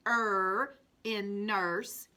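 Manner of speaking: Er in verse said slowly, er drawn out and rising; slow phonics drill. Shown as a demonstration. Teacher modelling er in verse for children. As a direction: stretch the er so kids hear it. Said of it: "phonics drill for the class".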